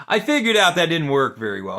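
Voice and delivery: light laugh into the line; amused. Real, not acted. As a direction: chuckle at your own failure. Laughing at his failed method.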